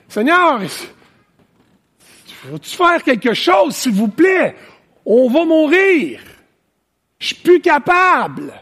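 panicked plea, high and loud, words stretched out; cri de panique. Acted. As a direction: cry out to God in acted desperation, voice thrown way up. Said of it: "En état de panique, en état d'anxiété". (supplication paniquée, criée et aiguë)

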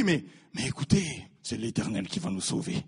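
low, soft, reassuring appeal; calme assurance. Acted. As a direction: drop low and quiet to reassure. Josué rassure le peuple. (assurance calme, voix basse et douce)

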